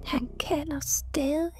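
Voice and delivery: slow, eerie whisper; soft, drawn-out, breathy murmur; mumbled. A narrator's reading. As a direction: murmur it in a hushed, haunted child voice.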